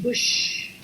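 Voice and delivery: airy wind whoosh; breathy whoosh. Shown as a demonstration. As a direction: breathe it like a sudden gust. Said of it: "all of a sudden".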